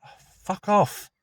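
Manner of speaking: high, sharp exasperated outburst at a car horn; annoyed driver swearing at a beeping car; real irritation. Real, not acted. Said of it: "Someone's impatiently beeping".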